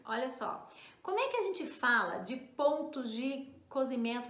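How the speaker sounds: ordinary talk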